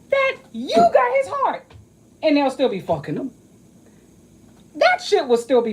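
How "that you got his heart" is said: mock high jealous voice. Mocking jealous women.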